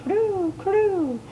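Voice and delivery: high, falling coo; cooing doves. A narrator's reading. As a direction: coo like a dove. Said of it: "The doves cooed".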